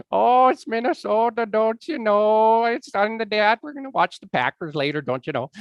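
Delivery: loud, high, exaggerated Minnesota accent; hammed-up Minnesotan with stretched vowels. Shown as a demonstration. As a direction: go big and playful on the Minnesota accent. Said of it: "I love the Minnesota accent".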